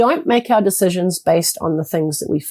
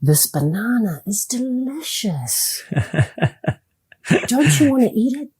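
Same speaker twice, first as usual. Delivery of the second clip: enticing; 2 semitones higher, 4 dB quieter than usual. Shown as a demonstration. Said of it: "practice the tone of your voice".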